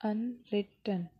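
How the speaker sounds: "Unwritten" slowly broken into अन-रि-टन; slow teacher's demo of unwritten in three beats; syllable by syllable. Shown as a demonstration. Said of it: "louder syllable कौन सा है". (Unwritten को धीरे, टुकड़ों में)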